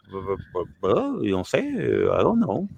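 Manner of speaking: put-on dazed kid. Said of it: "Y yo".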